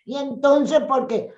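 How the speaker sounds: high-pitched put-on kiddie voice; play-acted childish whine; childish little-girl voice. Shown as a demonstration. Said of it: "habla niñada".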